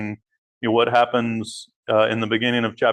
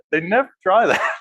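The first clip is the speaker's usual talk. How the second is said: higher, breaking into a laugh; amused; 13 semitones higher than usual. Real, not acted. Teacher joking about the complainers, amused and about to laugh. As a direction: let the repeat tip into laughter.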